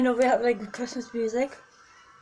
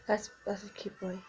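whisper; 8 dB quieter than usual. Real, not acted. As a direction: breathe it like a secret. Whispering over photos.

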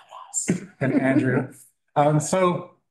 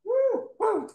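excited cheer. Real, not acted. Cheering after finishing the song.

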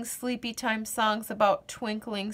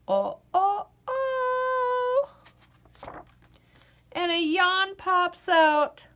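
mounting yawn; 7 semitones higher than usual. Acted. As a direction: let the yawn win. Yawn taking over.

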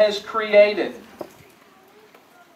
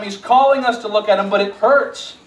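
ordinary talk

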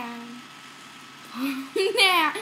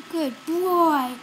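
ordinary talk

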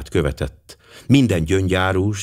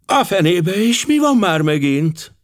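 high-pitched sleepy grumble; sleepy, irritable wife; 11 semitones higher, 3 dB louder than usual. A narrator's reading. Wife from inside the cabin to her knocking husband, sleepily cross. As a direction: whine it like someone just woken. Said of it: "álmos hangja felelt odabentről".

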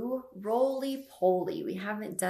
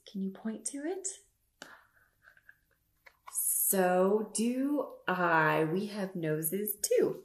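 put-on reading to a toddler; 4 semitones higher than usual. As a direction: read it to little kids. Storytime book read to kids.

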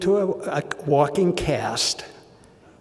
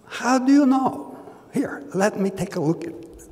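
doctor's doubting reply; 7 semitones higher than usual. Retold or imitated. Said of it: "I says".

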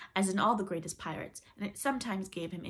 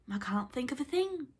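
higher-pitched, sorry admission; regretful; 7 semitones higher than usual. A narrator's reading. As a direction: admit defeat with regret. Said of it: "he said regretfully".

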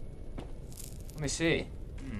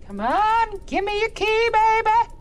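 very high, cooing plea; high put-on voice begging for the key; coaxing falsetto; 4 dB louder than usual. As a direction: sweet-talk the key out of someone.

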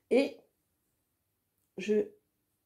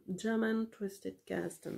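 a French speaker trying an English accent, slower; 7 dB quieter, slower than usual. Shown as a demonstration. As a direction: say German Twisted Cast in a mild, self-conscious English accent. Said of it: "désolée pour l'accent anglais". (accent anglais un peu maladroit)

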